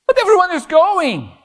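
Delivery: put-on high, pleading teen voice begging to go; whiny teenager. Preacher imitates a teen begging parents to go, high and whiny. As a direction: mimic a kid who begs his parents for permission.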